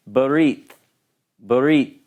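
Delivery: barit with a quick, barely-there first vowel, almost brit; Hebrew shva demo. Shown as a demonstration. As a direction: clip the first vowel of barit short and hurried. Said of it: "I'm gonna pronounce this for you".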